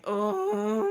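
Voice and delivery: loud, drawn-out moan; labour moan. Retold or imitated. Labouring in the pool, loud long moan. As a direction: groan through a contraction. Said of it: "I start laboring".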